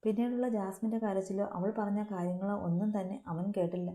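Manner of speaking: ordinary delivery, nothing marked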